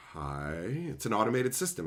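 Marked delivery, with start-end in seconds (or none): 0.1-0.5 hesitant quiet hi. Retold or imitated. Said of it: "And I'm like".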